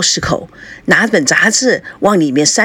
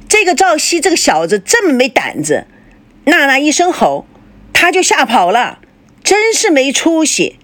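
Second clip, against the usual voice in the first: spiteful grumble, pitched higher; resentful; 7 semitones higher than usual. A narrator's reading. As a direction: spit it out with resentment. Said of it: "反而恨恨地说". (父亲恨恨地骂赵熙，语气愤恨)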